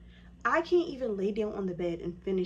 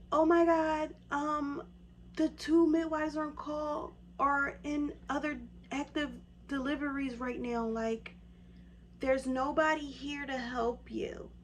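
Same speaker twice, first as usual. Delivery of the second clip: pitched way up in a flap; panicked receptionist; 7 semitones higher than usual. Retold or imitated. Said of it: "She said".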